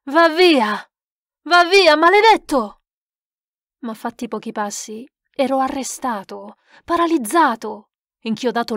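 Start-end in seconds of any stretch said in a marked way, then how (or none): 0.0-2.8 high, loud shout driving the spirit away; furious yell. Acted. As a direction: scream it out, fists raised against the unseen one. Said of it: "Mi slanciai urlando".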